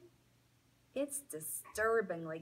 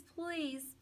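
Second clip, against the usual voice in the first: softer, small pleading whimper; 8 semitones higher, 5 dB quieter than usual. Acted. Dog whimpering to Hattie, soft and pleading. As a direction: let the begging shrink to a whimper. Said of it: "I whine some more".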